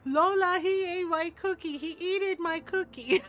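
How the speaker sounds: high, loud mock wail; imitated crying child. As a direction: wail a complaint like a little kid. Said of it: "then Shay started crying".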